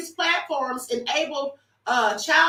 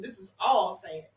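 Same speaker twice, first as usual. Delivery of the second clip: ordinary delivery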